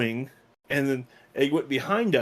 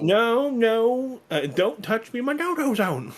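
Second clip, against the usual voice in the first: high squeal; 9 semitones higher, slower than usual. Pretend. Joking squeal.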